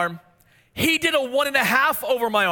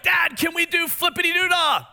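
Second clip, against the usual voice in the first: put-on eager kids begging; 8 semitones higher than usual. Boys begging their dad for the flip game, excited shout. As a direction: beg like an excited six-year-old. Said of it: "the boys were like".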